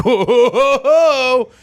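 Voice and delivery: high, loud, drawn-out laughing "whoa"; shocked shop clerk. Retold or imitated. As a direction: gasp at the late timing. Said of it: "They're like".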